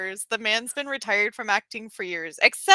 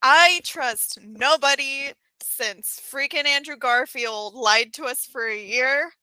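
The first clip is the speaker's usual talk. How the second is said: ordinary talk, nothing marked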